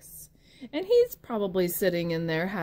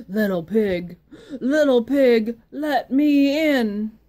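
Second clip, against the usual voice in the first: wolf voice; 4 semitones higher, 10 dB louder than usual. Acted. Wolf calling loudly to the pig at the door. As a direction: call out like the hungry wolf at the door.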